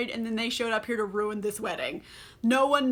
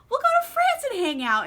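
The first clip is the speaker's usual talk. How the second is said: much higher, louder, gushing "go to France"; mock eager plea; 13 semitones higher, 5 dB louder than usual. Rochester begging Jane to run off, hammed-up high plea. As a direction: pitch the repeat way up like a swooning suitor. Retelling Rochester's proposal, second take.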